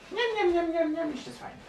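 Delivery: very high, squeaky little gnome; munching gnome. Acted. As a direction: chant it greedily while smacking on sugar.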